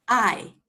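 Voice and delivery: "I" said as a sharp, short falling tone; fourth tone. Shown as a demonstration. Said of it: "And you may repeat after me".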